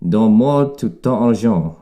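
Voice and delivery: a French-speaking mugger threatens a tourist, hammed-up French. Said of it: "mugged by a French speaker".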